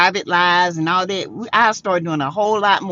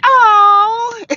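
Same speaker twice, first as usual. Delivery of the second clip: much higher, louder burst of laughter; delighted laugh; 11 semitones higher, 5 dB louder than usual. Real, not acted. Host greeting a viewer she waited for, loud delighted laugh. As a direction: squeal with joy at seeing a friend.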